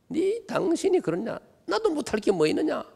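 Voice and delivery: mocking defiant wife. Pastor mimicking a wife to the congregation, high mock retort. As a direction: ham up a wife snapping back at her husband.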